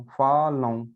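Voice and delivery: normal talking voice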